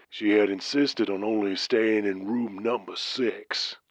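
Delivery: put-on Southern trucker's accent. Caller Randy tells his story.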